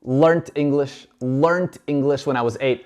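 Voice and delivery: "learned" ending in a crisp T, slow; British learnt. Shown as a demonstration. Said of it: "escuchemos cómo lo dice ella".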